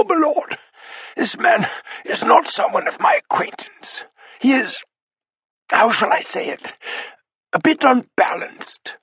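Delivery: put-on a frightened old butler, pitched high and strained; the butler reporting a menacing intruder, voice tight with fear; high, tight, hesitant servant voice; strained, muted butler. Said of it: "in such a strained and muted voice".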